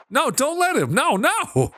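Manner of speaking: high-pitched dismayed pleading; alarmed protest. Real, not acted. Gamer begging them not to let him, high alarm. Alarmed as kid takes bike.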